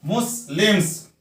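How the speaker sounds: मुस्लिम्स split as मुस-लिम्स; two separate beats in मुस्लिम्स, मुस and लिम्स; two syllables. Shown as a demonstration. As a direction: say मुस्लिम्स with a break after मुस. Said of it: "दो syllable है".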